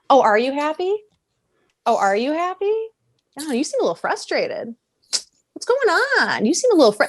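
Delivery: high, cooing fake concern with swooping pitch; mock a needling manipulator sowing doubt; Snoke. Villain undermines everything the kid feels, mock-sweet. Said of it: "then Snoke, like the motherfucker he is".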